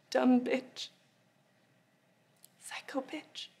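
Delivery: whispered insult. Acted. Taunting ex-lover, hissed insult.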